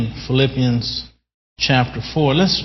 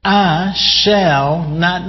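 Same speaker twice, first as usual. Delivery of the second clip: I shall not stretched slow and higher; confident emphasis; 4 semitones higher, much slower than usual. Shown as a demonstration. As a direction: press each word of I shall not. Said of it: "I like his confidence there".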